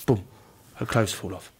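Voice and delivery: soft English actor voice; Patrick Stewart quote. Retold or imitated. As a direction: quietly recount a script idea. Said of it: "when he was in Extras".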